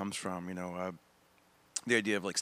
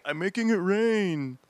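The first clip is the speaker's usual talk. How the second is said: slow, louder, a put-on old man's voice; 7 semitones higher, 5 dB louder, slower than usual. Comic answers his wife in a slow old-man voice. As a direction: drawl it out slowly like an old man.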